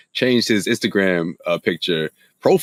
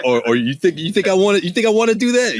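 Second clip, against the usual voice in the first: mock indignant father; 9 semitones higher than usual. Imitates a father before punishing.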